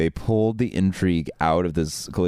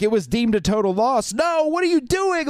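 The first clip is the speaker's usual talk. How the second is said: shrill, much higher outraged yell; he's ruined everything, yell it at him; retold yelling. Yelling at the repair guy about the total loss, outraged. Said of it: "So I start yelling at the guy".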